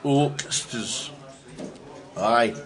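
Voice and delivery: "Oxters" rolled with a Scottish lilt, "Aye" drawn out slowly; Scottish accent. Shown as a demonstration. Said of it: "say it in a Scottish accent".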